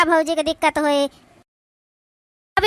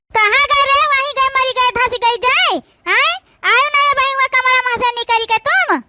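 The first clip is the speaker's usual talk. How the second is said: higher, mocking shout at the lazy daughter; scolding stepmother; 6 semitones higher, 3 dB louder than usual. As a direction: call her 'your majesty' with sharp scorn.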